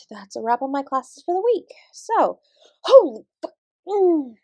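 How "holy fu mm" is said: sudden louder, higher cut-off curse; startled curse. Acted. Dance teacher startled by a hunter in her studio, surprised. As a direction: caught off guard, bite it off.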